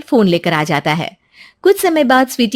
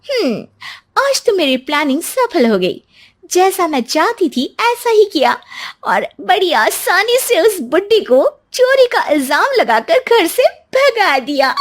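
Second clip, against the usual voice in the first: high, smug villainous musing; scheming self-talk; 10 semitones higher than usual. Acted. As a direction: gloat to herself about the plan. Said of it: "मन ही मन सोचती है".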